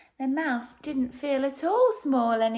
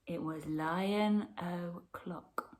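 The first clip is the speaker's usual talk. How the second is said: slow, hushed whisper; slow dramatic whisper; 4 semitones lower, 6 dB quieter, slower than usual. A narrator's reading. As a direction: whisper it slowly like a secret. Dramatic storybook announcement.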